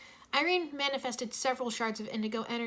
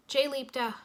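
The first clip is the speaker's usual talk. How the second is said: ordinary talk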